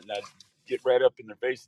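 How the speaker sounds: usual speaking voice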